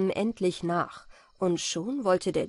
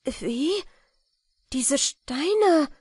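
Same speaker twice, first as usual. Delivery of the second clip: higher, louder astonished question; startled cry; 8 semitones higher, 6 dB louder, much slower than usual. A narrator's reading. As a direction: react as if taken aback. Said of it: "rief sie".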